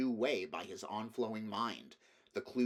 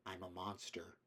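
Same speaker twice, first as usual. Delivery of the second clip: much softer, almost to himself; a horrified private confession; hushed inner thought; 3 semitones lower, 11 dB quieter than usual. A narrator's reading. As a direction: say it under your breath in dread.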